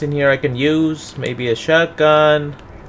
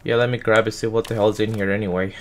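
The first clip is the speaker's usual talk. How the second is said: normal talking voice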